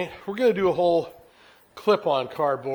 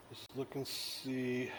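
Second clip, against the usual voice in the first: muttering; 3 semitones lower, 15 dB quieter, much slower than usual. Real, not acted. Muttering while checking.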